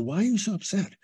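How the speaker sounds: high-pitched, imitating his own worried question; retold concerned question. Man retelling a phone call to his upset girlfriend, concerned.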